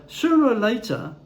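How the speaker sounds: ordinary delivery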